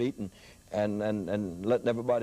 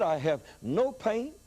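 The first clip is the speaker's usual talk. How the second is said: joyful healed man; 9 semitones higher than usual. Retold or imitated. Healed man praising God to the preacher, joyful and high. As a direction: sing out the relief of being healed. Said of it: "He said".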